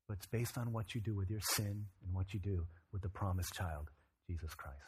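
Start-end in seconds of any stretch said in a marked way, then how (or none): none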